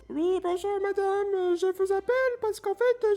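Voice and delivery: very high caller voice, put-on Arabic accent; hammed-up Arabic accent. Pretend caller phones the caf with a question, hammed-up Arabic accent. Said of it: "j'ai fait un accent arabe".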